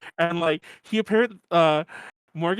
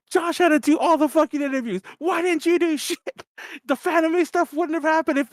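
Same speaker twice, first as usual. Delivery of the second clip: higher ranting complaint; mock drunk yell; 10 semitones higher than usual. Drunk host ranting at friends about interviews, mock angry. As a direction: rant at them half-hammed. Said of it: "I was yelling at everyone".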